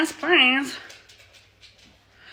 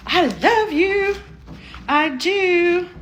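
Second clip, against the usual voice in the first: cooing at shoes; 6 semitones higher, much slower than usual. Real, not acted. Talks lovingly to the shoes.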